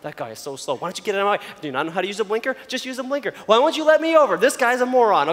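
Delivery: acted-out exasperated driver; mock complaining. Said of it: "just thinking complaining the whole time".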